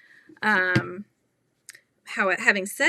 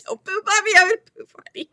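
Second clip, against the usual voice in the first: mock warning; 10 semitones higher, 5 dB louder, much faster than usual. Mock moral voiced, laughing.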